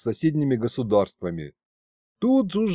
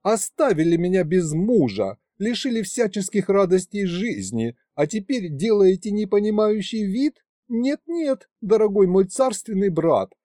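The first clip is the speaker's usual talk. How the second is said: coquettish widow; 7 semitones higher than usual. A narrator's reading. Queen pleading playfully, voiced.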